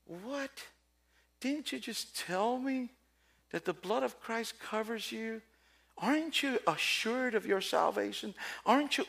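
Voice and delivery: incredulous. Real, not acted. Preacher reacts to the contradiction.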